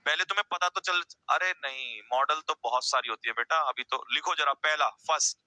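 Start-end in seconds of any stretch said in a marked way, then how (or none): none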